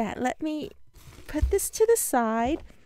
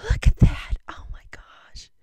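a hushed whisper of wonder; whispered, awestruck; awed whisper. Real, not acted. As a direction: breathe it out in awe. Awed by the 3D card.

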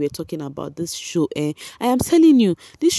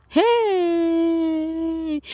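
high, long drawn-out "hey"; exasperated exclamation; 11 semitones higher than usual. Real, not acted. Vlogger reacting to the housemates' antics, exasperated. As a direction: hold one high, exclaiming note.

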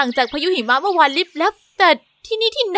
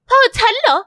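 much higher, louder gasp of disbelief; can't believe it's her father; breathless startled question; stunned disbelief; 9 semitones higher, 4 dB louder than usual. Acted. King looks like her late father.

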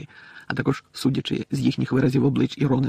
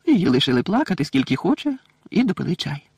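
ordinary delivery, nothing marked

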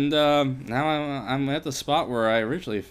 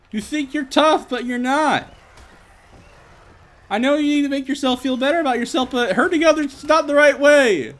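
high, loud preachy scolding; melodramatic scolding; 14 semitones higher, 6 dB louder than usual. Pretend. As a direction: lecture the bully melodramatically.

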